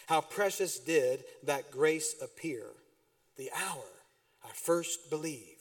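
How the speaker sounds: slow, soft hymn recitation; hymn recited. Acted. Pastor reciting Amazing Grace to the church, slow and soft. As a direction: let each line of the hymn sink in slowly.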